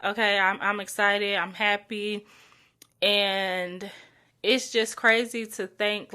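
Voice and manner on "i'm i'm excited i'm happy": ordinary delivery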